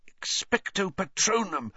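high but feeble spell; strained, high incantation; weakened desperation. A narrator's reading. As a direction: push out the spell with failing strength. Blinded, weakening, still fighting.

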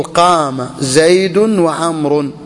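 very slow, each word drawn out for dictation; slow example. Shown as a demonstration. Teacher dictating an example sentence to students, very slowly. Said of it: "تقول".

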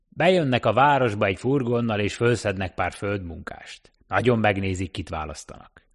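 a wary taxi driver warning a stranger off; cabbie voice. Acted. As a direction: talkative cab driver warning off a newcomer.

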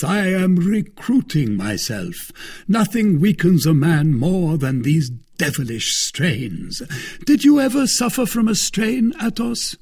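high-pitched, expansive complainer; self-satisfied Porthos. A narrator's reading. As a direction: hold forth grandly, pitched high. Voices Porthos holding forth.

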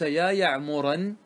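يعمرا drawn out slowly, ending in -an; wrong accusative ending. Shown as a demonstration. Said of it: "نصبته". (نطق خاطئ ممدود يا يعمرًا)

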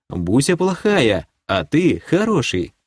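медленно, как малышу; успокой простодушного эльфа; как с ребёнком. Acted. Говорит с эльфом как с ребёнком. (нараспев, как ребёнку)

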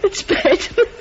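can't stop laughing while sorry; laughing apology. Acted. Computer apologises to the astronaut, laughing through it.

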